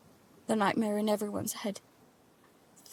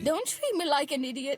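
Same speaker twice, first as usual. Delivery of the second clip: indignant retort; 5 semitones higher, 5 dB louder than usual. Acted. Girl snaps at father.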